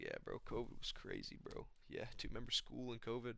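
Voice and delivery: soft, muttering young-dude impression; Joshua Van. Young guy telling older friends about covid, softly mumbled impression. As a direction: quietly reminisce like a soft-spoken young bro. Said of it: "He's like".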